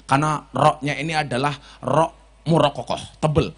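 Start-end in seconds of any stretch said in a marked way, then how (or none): none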